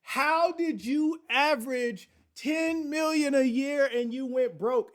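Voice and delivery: loud, high-pitched baffled question; incredulous paupers. Acted. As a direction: demand it in exaggerated disbelief. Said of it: "all of us paupers out here are like".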